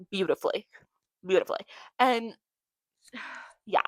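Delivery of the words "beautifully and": ordinary talk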